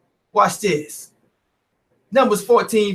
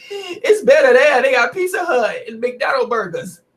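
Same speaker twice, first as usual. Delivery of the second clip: mock crying; 6 semitones higher than usual. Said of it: "Listen to the rest of the weaklings".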